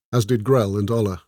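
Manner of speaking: ordinary delivery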